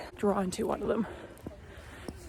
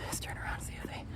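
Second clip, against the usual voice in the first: real hush, much softer, half whispered; 7 dB quieter than usual. Real, not acted. Vlogger filming the trees outdoors, softly hushed. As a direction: speak softly so as not to disturb.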